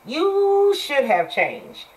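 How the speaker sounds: slow, high, every word leaned on; slow high insistence; emphatic scolding. Real, not acted. Scolding listeners about changing.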